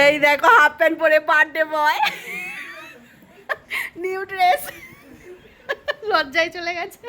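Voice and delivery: high, loud laughing teasing; laughing tease. Real, not acted. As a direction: tease him through laughter. Teasing the shy birthday boy.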